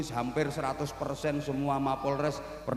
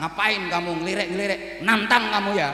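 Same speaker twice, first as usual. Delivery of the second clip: quick challenge, building louder; mock angry; 3 semitones higher, 4 dB louder than usual. Man confronting someone who glanced at him, mock angry. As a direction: act out touchy anger, ending loud. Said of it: "bisa marah-marah".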